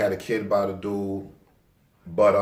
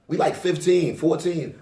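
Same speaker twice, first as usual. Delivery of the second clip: higher-pitched retold disbelief; incredulous; 7 semitones higher, slower than usual. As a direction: protest how absurd this is. Incredulous about husband at that age.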